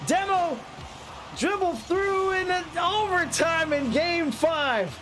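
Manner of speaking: rising, building play-by-play call; live play-by-play. Acted. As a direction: call the match as it unfolds, climbing with the action.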